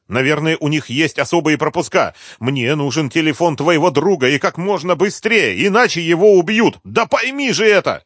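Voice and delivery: high, insistent, agitated plea; urgent pleading. A narrator's reading. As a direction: press them desperately to understand. Rimma urgently pleads, gets interrupted.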